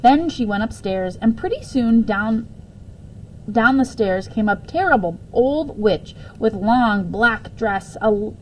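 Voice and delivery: ordinary delivery, nothing marked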